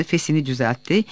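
usual speaking voice

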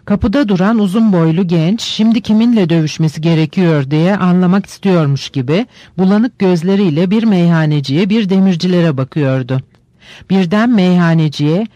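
ordinary delivery, nothing marked

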